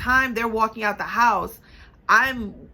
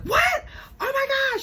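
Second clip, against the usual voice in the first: much higher shocked gasp of a mother; freaking out. Retold or imitated. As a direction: squeal it like a panicked mom. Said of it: "freaking out and doing the most".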